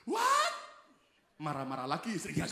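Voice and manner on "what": much higher, louder shocked cry; shocked shriek. Acted. Wife shrieking at her husband, high and loud. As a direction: shriek in outrage.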